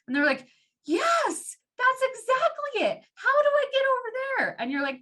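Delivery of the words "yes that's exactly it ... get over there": high, eager, falsetto customer reply; mock high-pitched thrilled customer.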